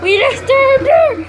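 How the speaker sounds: high, loud mock alarm; excited shout. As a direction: raise it in alarm. Repeat much higher, louder.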